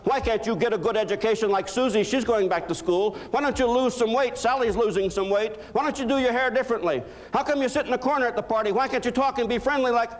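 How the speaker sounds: a contemptuous husband criticizes his wife, mock nagging. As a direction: pick at her faults one by one. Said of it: "criticizing who they are".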